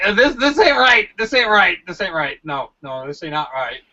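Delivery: acted freak-out trailing into a mumble. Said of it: "so you're freaking out".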